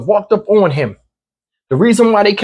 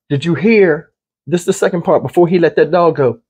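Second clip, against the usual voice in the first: usual speaking voice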